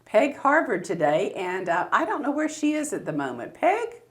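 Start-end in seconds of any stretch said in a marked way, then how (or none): 3.5-4.0 high, raised call across the room; sing-song calling for a guest who isn't there yet. Real, not acted. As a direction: call her in from offstage. Host calling for absent guest.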